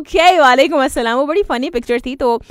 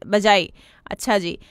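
ordinary talk

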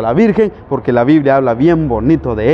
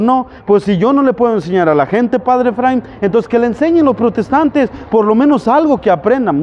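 imitated priest, higher pitched; complaining priest; 7 semitones higher than usual. Priest excusing himself to Padre Efraín, higher imitated voice. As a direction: voice a priest passing the job on, pitched up. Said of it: "Imagínese ese sacerdote que dijo".

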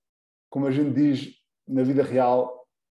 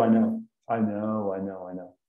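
I know, I know murmured quickly, fading softer; casual backchannel; 5 semitones lower, 6 dB quieter than usual. Shown as a demonstration. Teacher acting a listener nodding along in conversation. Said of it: "eles usam I know".

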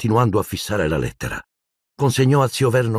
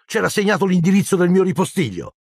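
much higher, indignant protest; boy's angry retort; 11 semitones higher, 3 dB louder than usual. A narrator's reading. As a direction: argue back hotly. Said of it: "disse Harry arrabbiato".

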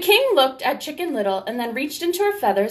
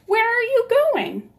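high, louder little hen question; Henny Penny voice; 13 semitones higher, 4 dB louder than usual. Acted. Henny Penny greets Chicken Little, high chirpy question.

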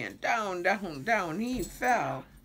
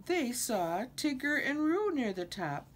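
ordinary delivery

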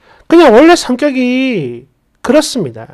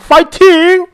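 high-pitched mock pep cheer; cheery boyfriend; 6 semitones higher, slower than usual. Mimicking boyfriend who never asked why, high peppy cheer. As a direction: imitate an oblivious cheerleader of a boyfriend.

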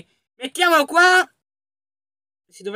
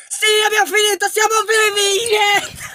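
triumphant cheer; 7 semitones higher than usual. Real, not acted. Gamer cheering with friend after escaping, triumphant. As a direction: celebrate surviving.

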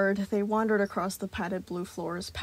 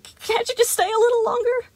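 high, breaking plea; voice cracking; 9 dB louder than usual. A narrator's reading. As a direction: let the voice crack as you beg. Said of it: "voice cracking".

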